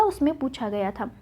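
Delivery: ordinary talk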